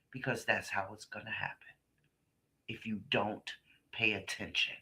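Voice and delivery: low, hushed grave warning; quiet warning. Retold or imitated. Father warning his little girl in court testimony, hushed and grave. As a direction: drop it to a hushed, serious caution. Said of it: "he said to her".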